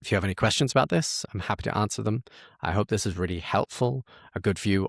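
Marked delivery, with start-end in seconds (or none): none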